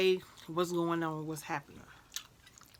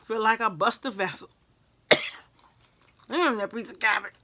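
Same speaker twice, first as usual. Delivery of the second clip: usual speaking voice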